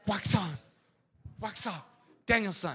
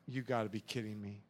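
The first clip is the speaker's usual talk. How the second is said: much softer, lower, dismissive; unimpressed aside; 13 semitones lower, 14 dB quieter than usual. Retold or imitated. Believer unbothered by the enemy, soft scoff. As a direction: shrug it off under your breath.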